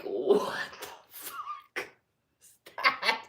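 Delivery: high, stretched-out stunned cry; slow incredulous squeak; shocked disbelief. Retold or imitated. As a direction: react like you've just been snubbed. Bestie walks into house, shocked.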